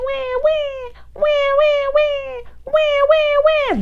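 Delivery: squeaky high wee, wee sounds; very high playful baby squeaks; babyish wee. Pretend. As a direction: squeal it high like tiny babies. Babies on the bus go wee.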